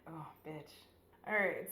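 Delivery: normal talking voice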